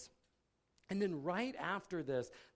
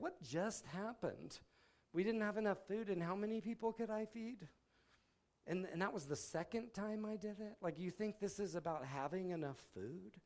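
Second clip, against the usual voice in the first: higher, incredulous questioning; exasperated Jesus; 6 semitones higher than usual. Retold or imitated. Jesus scolds disciples about bread, incredulous. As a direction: disbelief at the disciples. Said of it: "that's what he said".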